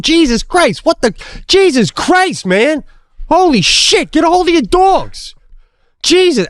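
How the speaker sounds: high-pitched, exasperated yelling; retold angry yelling. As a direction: berate a careless dog owner. Retold outburst at dog owner.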